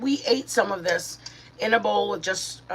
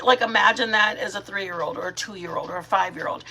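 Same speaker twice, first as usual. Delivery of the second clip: ordinary talk, nothing marked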